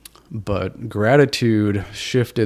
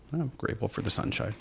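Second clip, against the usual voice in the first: nonchalant; 2 semitones higher, 9 dB quieter than usual. Retold or imitated. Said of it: "Just totally nonchalant".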